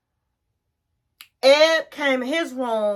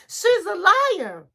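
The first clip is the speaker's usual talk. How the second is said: higher, drawn-out mock protest; indignant accusation; 6 semitones higher, slower than usual. Client accusing partner to the therapist, acted-out indignation. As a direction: act out an accusation, slow and pitched up. Retold quarrel, accusation repeated.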